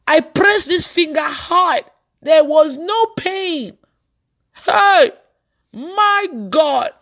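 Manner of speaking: high, drawn-out exclamations of wonder; amazed. Retold or imitated. Preacher reliving how a hurt finger felt no pain, amazed.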